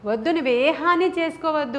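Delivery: retold shout. Jailer about to harm himself, they shout urgently. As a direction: call out to stop him, pleading. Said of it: "గట్టిగా అరిచారంట".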